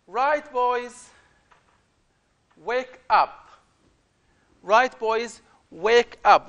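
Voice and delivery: put-on loud, high call to wake the boys; shouting wake-up call. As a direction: call out like a camp guide rousing sleepers. Teacher reads guide waking boys.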